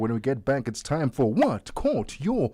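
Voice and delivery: big showy radio build-up; segment announcer. Real, not acted. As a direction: stretch it out like a game-show announcer. Host announces a show segment.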